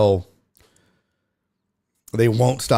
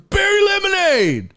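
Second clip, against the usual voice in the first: heckler's yell. Retold or imitated. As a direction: imitate a heckler hollering a name, pitched way up. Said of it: "I can hear him yelling".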